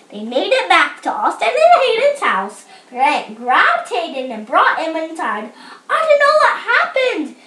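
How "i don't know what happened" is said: high-pitched, excitable aunt; flustered explaining. A narrator's reading. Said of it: "explained the aunt".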